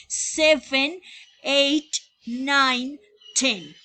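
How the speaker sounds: loud, clear counting for the class; counting aloud. Shown as a demonstration. Teacher drilling numbers to students, loud and clear. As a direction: call out each number firmly. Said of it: "ahí están los números del uno al diez".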